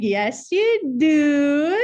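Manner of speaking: put-on high, slow teasing northern accent; exaggerated long-drawn northern accent, playful; mock northern accent. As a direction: mimic the northern accent, dragging out each word. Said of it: "making fun of my northern accent".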